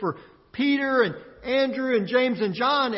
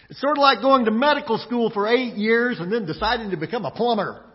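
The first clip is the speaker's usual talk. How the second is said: normal talking voice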